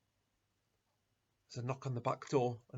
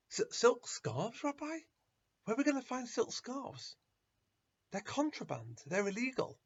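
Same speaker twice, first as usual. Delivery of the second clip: high, stammering shocked question; shocked disbelief; 10 semitones higher than usual. Acted. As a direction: recoil at an impossible request, pitched well up. Disciples shocked at strange request.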